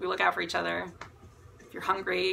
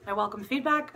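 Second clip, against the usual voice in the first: ordinary talk, nothing marked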